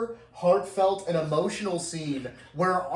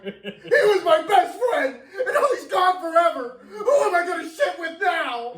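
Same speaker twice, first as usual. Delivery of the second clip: much higher, louder hammed-up wail with laughter; mock screaming fit; 12 semitones higher, 6 dB louder than usual. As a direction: parody a sobbing tantrum, yelling. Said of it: "screaming shit fit".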